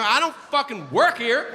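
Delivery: exasperated. Retold or imitated. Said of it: "I go".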